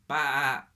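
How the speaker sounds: loud, high imitated baa; childish loud baa; lamb bleat. A narrator's reading. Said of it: "He sounded like a little lamb".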